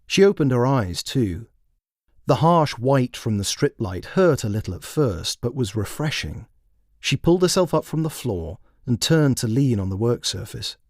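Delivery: plain reading voice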